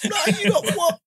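squeaky incredulous no; high-pitched retold objection; high mock protest. Quoting argument in car.

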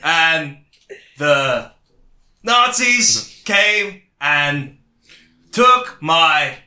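very slow, long pauses between words; halting recall. Retold or imitated. Mocking the biopic's story, halting and very slow. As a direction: dodder through a made-up memory.

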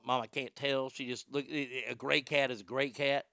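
ordinary delivery

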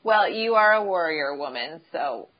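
ordinary talk

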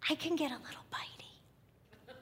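hushed confiding whisper; whispered aside. Real, not acted. Preacher confiding to congregation, playful whisper. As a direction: let them in on a secret. Joking aside about her temper.

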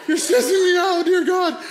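high, loud comic stress; mock panicked yell. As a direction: wail it at them in mock panic. Panicked driver answers the cholos.

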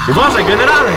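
loud high cry of alarm; urgent warning shout. Acted. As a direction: yell a warning at the general. Young companion warns the general. (głośny okrzyk ostrzeżenia)

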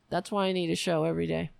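ordinary talk

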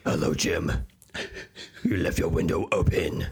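low, looming superhero voice; Batman. Acted. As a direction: greet him low and mysterious from the window.

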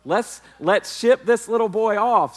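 high, loud, slow teasing call to his wife; hammed-up playful yell across the room; mock shouting joke. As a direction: ham it up as if shipping the kid off. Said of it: "I go".

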